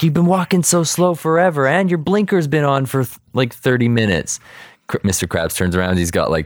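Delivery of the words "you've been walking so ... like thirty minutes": high mock-irritated passer-by, easing down; acted nagging complaint, pitched up; exasperated complaint. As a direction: imitate a fed-up driver griping. Passer-by complains about slow Krabs.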